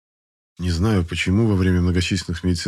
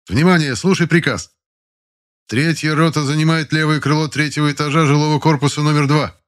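high, loud military order; commanding officer; 8 semitones higher, 3 dB louder than usual. Acted. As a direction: give the order to the ranks. Ротный отдаёт приказ строю. (командный голос офицера)

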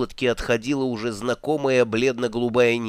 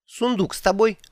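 высокий голос туриста; 8 semitones higher than usual. Acted. Голос Два Цветка.

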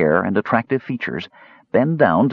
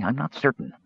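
weak, hushed voice; 2 semitones higher, 5 dB quieter than usual. A narrator's reading. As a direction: answer faintly, as if drained. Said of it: "the mage said weakly".

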